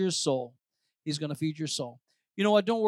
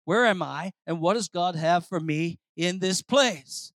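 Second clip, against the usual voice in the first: ordinary delivery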